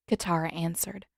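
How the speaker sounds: ordinary delivery, nothing marked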